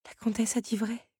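a hint of Belle, stating it softly; lightly voiced young woman. A narrator's reading. Belle constate doucement, voix de jeune fille. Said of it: "constata Belle".

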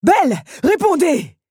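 high, loud, slow shout; thundering demand. A narrator's reading. Beast ordering Belle to answer, shouting. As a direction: pound out the order. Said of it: "tonna-t-il".

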